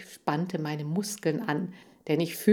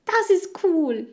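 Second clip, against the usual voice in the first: high, loud, drawn-out whoop; squealing hamster enthusiasm; delighted cheer; 13 semitones higher, 6 dB louder, slower than usual. Acted. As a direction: exclaim with sudden glee.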